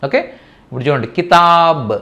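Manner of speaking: ordinary delivery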